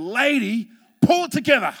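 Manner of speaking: loud retold order to a panicking lady; retold snap. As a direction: tell her to pull it together, sharp and loud. Snapping at panicking lady in tunnel.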